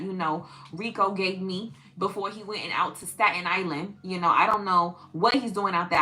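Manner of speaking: put-on New York Italian-American accent; hammed-up Italian-American girl from New York; mafiosa Italian girl. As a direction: play a Staten Island mob girlfriend. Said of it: "some mafiosa Italian girl".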